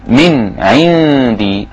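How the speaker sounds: من عندي with the ن of من said clearly, no nasal hiding; clear nun. Shown as a demonstration. Teacher shows idh-har of ن in من عندي. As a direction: sound the ن of من fully before ع. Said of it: "يبقى نظهر النون الساكنة".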